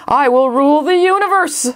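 high, rising megalomaniac boast; triumphant villain. Acted. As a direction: crow it like a cartoon villain. Villain declares plan to rule.

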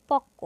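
পক্ক said "pok-ko", rising at the end; doubled consonant. Shown as a demonstration. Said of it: "যুক্ত ব্যঞ্জনটির দ্বিত্ব উচ্চারণ হবে".

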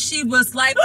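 normal talking voice

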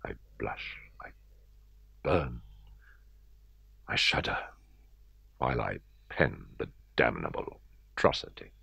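whispered, breaking off between words; ashamed, barely voiced; hushed confession. A narrator's reading. Narrator confessing to the reader his cruelty to the cat, hushed.

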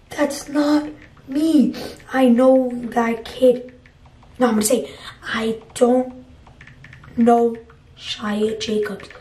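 halting, drawn-out delivery with gaps; slow typed reply. Acted. Vlogger typing a denial to the owner, slow word by word. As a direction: say each word slowly as you type it.

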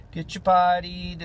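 normal talking voice

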